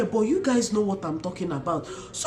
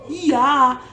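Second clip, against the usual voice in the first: excited shout; 4 semitones higher, 5 dB louder than usual. Real, not acted. Excited exclamation.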